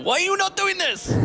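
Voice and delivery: high, hammed-up yell down the microphone; yelling at the stage in jest; mock outraged yell at the team. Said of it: "yell down the microphone".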